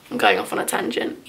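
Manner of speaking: soft whispered tangent; whispered aside. Real, not acted. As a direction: breathe it like a private aside. Whispered aside while reading letters.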